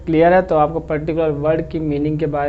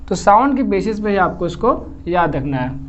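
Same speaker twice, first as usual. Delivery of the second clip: ordinary delivery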